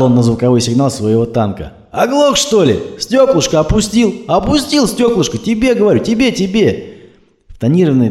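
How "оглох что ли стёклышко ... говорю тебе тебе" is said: swaggering tough guy barking from his jeep; cocky thug. Acted. Thug honking at a driver.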